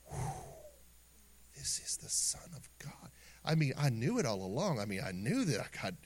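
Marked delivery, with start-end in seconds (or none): 0.0-3.1 fluisterend, vol ontzag; awed whisper. Retold or imitated. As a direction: fluister het alsof je je adem inhoudt van verwondering. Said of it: "the emotions that are coming over you going".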